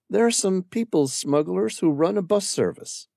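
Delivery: put-on a young woman remembering her husband; Amira's voice. Amira telling the stranger about her late husband, wistful.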